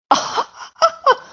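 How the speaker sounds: very high squealy delighted giggle; high cartoon laugh. Owner laughing over Scamp, very high giggle. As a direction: laugh like an overjoyed cartoon character. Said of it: "how about this one where it goes".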